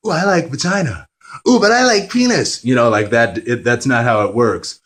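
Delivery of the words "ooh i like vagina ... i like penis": high, rising mock newborn voice; mock baby voice. A vlogger voicing a newborn fresh out of the womb, high and squeaky. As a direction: squeal it like a baby discovering itself. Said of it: "you're like".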